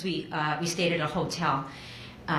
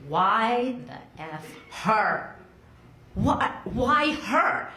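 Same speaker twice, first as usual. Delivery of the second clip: anguished why; much slower than usual. Retold or imitated. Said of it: "I was like".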